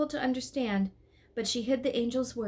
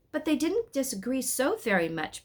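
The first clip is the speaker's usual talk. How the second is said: higher-pitched mild protest; 6 semitones higher than usual. A narrator's reading. Mama objects to Papa about the quarrel, protesting. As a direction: object with gentle insistence. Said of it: "protested Mama".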